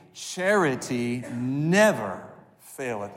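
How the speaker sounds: slow, drawn out unison reading; slow congregational recital. Shown as a demonstration. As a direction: chant it slowly with the congregation. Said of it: "Would you say those words with me".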